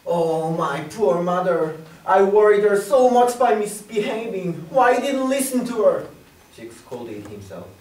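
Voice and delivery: loud, high anguished lament; loud high grieving cry. A narrator's reading. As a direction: wail with guilt for mother. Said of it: "Little Frog cried and cried".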